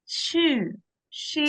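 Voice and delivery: "Shu" said with a smile, through the teeth; smiling through teeth. Shown as a demonstration. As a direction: spread lips and push "shu" through the teeth. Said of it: "ready to smile and repeat after me".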